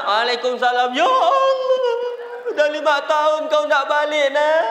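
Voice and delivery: high, loud hammed-up motherly wail. Mother to child gone five years, acted wailing.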